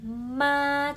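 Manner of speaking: MA held high and level; "Ma" high, long and unbending; level first tone. Shown as a demonstration. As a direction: sing out MA on one straight high note. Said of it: "itu dia itu lurus".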